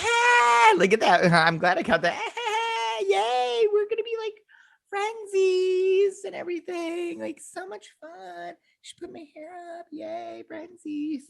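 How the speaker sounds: very high, soft squealing gush; squealing ditzy girl. Retold or imitated. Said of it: "She's like".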